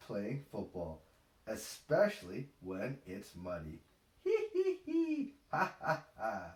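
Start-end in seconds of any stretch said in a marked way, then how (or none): 4.2-6.5 high hee-hee falling to ha-ha; read-out laugh. Acted. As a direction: laugh out the book ending. Reader laughs at book end.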